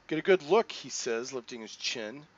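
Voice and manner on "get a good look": put-on a touch louder, defiant teen; bitter mutant boy. Pico challenges the staring stranger, defiant. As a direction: throw it back at the stranger who stares.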